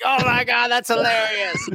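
high, slow shout of delight. Retold or imitated. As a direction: cry out, cracking up. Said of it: "I'm like".

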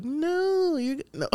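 high-pitched celebrity impression. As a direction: go way up high, cooing the words. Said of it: "[name] voice would be like".

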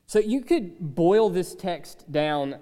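ordinary talk, nothing marked

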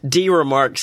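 usual speaking voice

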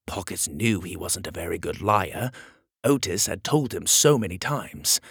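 plain reading voice

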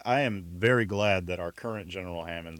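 ordinary delivery

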